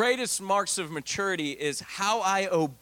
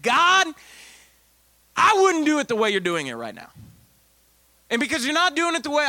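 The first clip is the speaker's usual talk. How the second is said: exasperated complaint; 9 semitones higher, 6 dB louder than usual. Retold or imitated. Complaining to God in quote.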